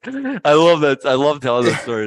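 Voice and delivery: delighted. Real, not acted. Delighted after telling story.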